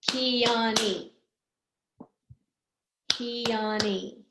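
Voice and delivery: KEONE in three even, connected beats; syllable by syllable. Shown as a demonstration. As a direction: count out KE, O, NE in KEONE. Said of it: "Keone has three".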